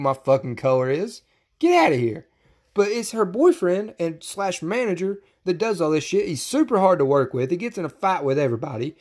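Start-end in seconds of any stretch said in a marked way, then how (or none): none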